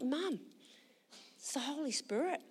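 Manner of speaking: soft, hushed explaining; gentle reassurance. Retold or imitated. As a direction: break it to her softly. Gently explaining to weeping mum.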